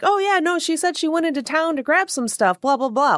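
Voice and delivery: high, breezy fake-innocent voice; mock family excuse. Vlogger mocking the family's excuse, high fake breezy voice. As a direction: toss off the lie in a high sing-song.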